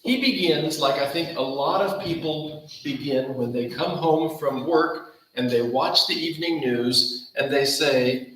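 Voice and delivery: ordinary delivery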